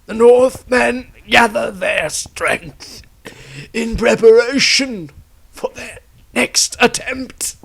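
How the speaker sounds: high, loud, slow and straining, close to tears; a desperate last warning that breaks; dying plea. Acted. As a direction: Tristram begs Rysandria to warn the Empire. Dying Tristram's urgent warning.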